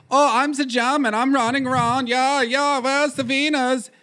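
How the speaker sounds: high, hammed-up German accent; mock German accent. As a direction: put on a silly, high-pitched German voice. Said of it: "that's some xenophobia".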